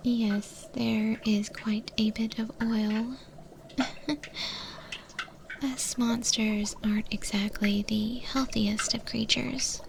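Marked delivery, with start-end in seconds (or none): 3.8-5.2 breathy little laugh; quiet unvoiced giggle; soft whispered chuckle. Monster chef chuckles softly.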